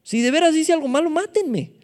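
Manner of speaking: high-pitched, colloquial acted protest; David pleading. Preacher acts David protesting to his friend Jonatán, high-pitched plea. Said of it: "Todavía le dijo a Jonatán".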